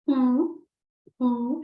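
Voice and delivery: "Oo" held, mouth pushed forward; French oo sound. Shown as a demonstration. Teacher asks learners to follow her, held rounded "oo". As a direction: sustain a rounded French "oo" for others to copy. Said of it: "practice with me the oo sound".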